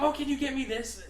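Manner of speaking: high, imitated sweet request; coworker's request. Coworker asking box guy for supplies, high imitated voice. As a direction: pitch it up as the needy coworker. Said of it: "She's like".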